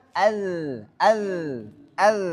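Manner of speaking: "Al" ending in a held th as in "this"; sustained soft buzz ending "Al"; flowing dhal. Shown as a demonstration. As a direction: let the voiced th closing "Al" run on. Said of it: "Huruf dal".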